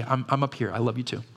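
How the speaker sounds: usual speaking voice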